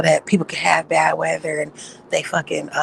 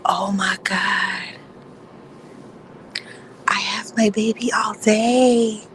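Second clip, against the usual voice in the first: whisper; 4 semitones higher, slower than usual. Real, not acted. Mother whispering near her sleeping baby, hushed whisper. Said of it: "whispering to sleeping baby".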